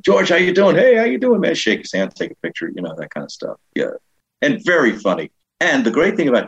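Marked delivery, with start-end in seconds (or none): 0.0-1.5 high-pitched eager greeting; gushing airport fans. Retold or imitated. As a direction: imitate strangers hailing a celebrity. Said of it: "people at the airport come".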